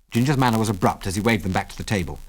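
ordinary delivery, nothing marked

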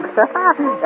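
bright burst of laughter; delighted laugh. Acted. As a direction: laugh at the joke. Laughing at the gag.

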